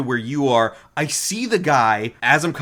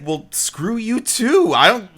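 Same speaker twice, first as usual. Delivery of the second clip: higher, slow huffy retort; upset retort; 7 semitones higher, 3 dB louder, slower than usual. Retold or imitated. Kimiko getting upset at Ryo, huffy retort. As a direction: snap back hurt. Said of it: "she's like".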